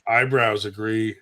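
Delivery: ordinary delivery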